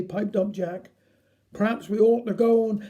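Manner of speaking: plain reading voice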